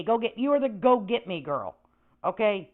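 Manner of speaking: normal talking voice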